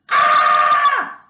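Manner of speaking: very high, loud imitated shriek; retold scream. Patient screaming before the stent is pulled, imitated shriek. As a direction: shriek in mock terror. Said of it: "I was already screaming".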